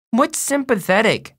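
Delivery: put-on high, puzzled small boy; little brother. A narrator's reading. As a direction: ask like a second-grader, pitched high. Second-grader voiced in story.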